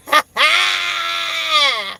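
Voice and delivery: high burst of laughter. Real, not acted. Loud laugh at a comment.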